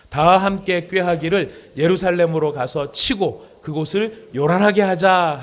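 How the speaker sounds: very loud, slow Bible reading; scripture reading. Acted. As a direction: proclaim the verse strongly to the congregation. Reads Bible passage aloud. (아주 크고 느린 성경 봉독)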